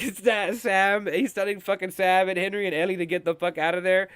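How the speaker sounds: ordinary delivery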